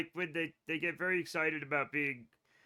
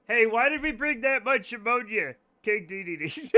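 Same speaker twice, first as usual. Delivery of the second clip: calling out; 5 semitones higher, 6 dB louder than usual. Real, not acted. Calls out to teammate.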